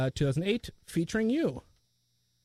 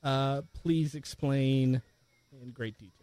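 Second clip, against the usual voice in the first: slow, halting, trailing off softer; typing aloud; 3 semitones lower, 4 dB quieter, slower than usual. Real, not acted. Host typing the question for the character, slow and halting. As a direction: say it slowly as you type it.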